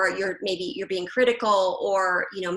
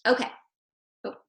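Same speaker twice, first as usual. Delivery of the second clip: ordinary talk, nothing marked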